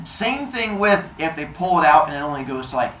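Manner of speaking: normal talking voice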